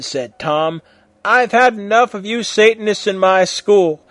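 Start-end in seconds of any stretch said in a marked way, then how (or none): 1.2-4.0 high, loud angry shout. A narrator's reading. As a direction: blast them out of the school. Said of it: "shouted Dumbledore".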